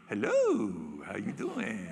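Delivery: low, soft suave greeting; low smooth male tiger. Retold or imitated. Male tiger greeting the female, low and suave. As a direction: play the smooth suitor. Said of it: "He goes right up to her".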